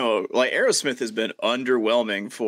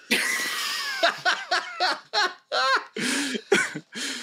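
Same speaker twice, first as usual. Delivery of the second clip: a real, long, high-pitched burst of laughter. Real, not acted. Cracking up at his own titty joke, a long high laugh.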